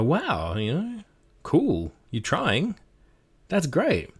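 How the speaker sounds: high, slow, lightly mock impressed; impressed friend. Player praising the GM's accent, high mock impressed. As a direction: gush a bit like a kind player. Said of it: "they'll probably go".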